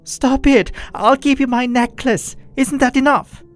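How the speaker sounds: high, loud desperate shout; desperate yell. Retold or imitated. Narrator yelling at possessed boyfriend to stop hitting.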